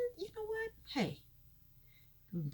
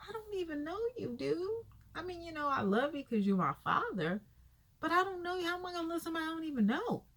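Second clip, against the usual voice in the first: high, exasperated retort, faster at the end; hammed-up indignant son blowing up at dad; mock outrage, voice pitched up; exaggerated outraged son; 7 semitones higher, faster than usual. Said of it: "He didn't say it like that".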